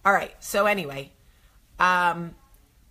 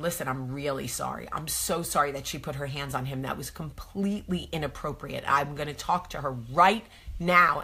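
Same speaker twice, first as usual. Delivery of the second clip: low, sincere apology; 5 semitones lower, 5 dB quieter than usual. Retold or imitated. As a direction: lower and much softer apology. Apologizing to other mom.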